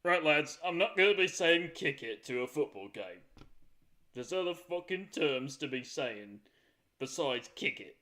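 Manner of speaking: loud British lad voice; put-on British accent. Brit objecting to football script, hammed-up British accent. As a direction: address the lads in a broad, put-on British accent. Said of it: "I don't know what accent I just did there".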